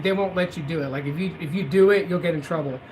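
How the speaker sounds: ordinary talk, nothing marked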